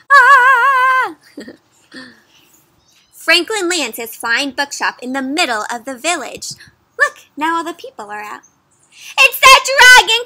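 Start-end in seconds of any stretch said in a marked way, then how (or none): 0.1-1.1 high, loud playful scream; mock scared scream. Showing kids how the riders feel, a playful scream. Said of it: "kind of like". 9.2-10.2 high, loud alarmed shout; frightened cry. Acted. Fisherman spotting the dragon in the village, crying out. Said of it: "cries the fisherman".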